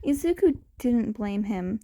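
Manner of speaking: usual speaking voice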